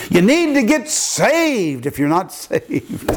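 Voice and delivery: high, slow call-out with a laugh; lifted preaching voice. Retold or imitated. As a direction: call it across the graveside so he can't miss it. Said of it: "I lifted my voice like I can do".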